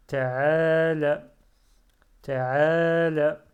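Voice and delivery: loud, careful model reading of Ta'ala for pupils; Ta'ala said loud and clear, each syllable full. Shown as a demonstration. Teacher reading aloud to learners, loud and careful. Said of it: "teacher models Arabic reading".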